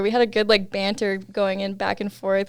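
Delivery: normal talking voice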